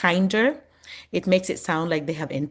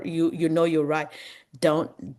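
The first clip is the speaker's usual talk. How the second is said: ordinary talk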